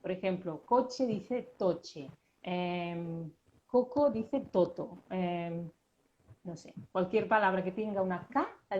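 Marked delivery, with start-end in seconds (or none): 0.7-2.1 toche with t instead of the k in coche; slowly contrasting coche and toche. Shown as a demonstration. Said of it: "lo dice como una T". 3.7-5.0 toto with t instead of the k in coco; slowly contrasting coco and toto. Shown as a demonstration. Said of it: "lo dice como una T".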